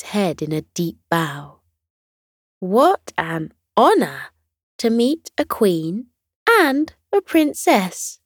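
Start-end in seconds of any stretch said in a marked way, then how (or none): 2.6-8.2 put-on honored young dinosaur. Dewey bows, honored.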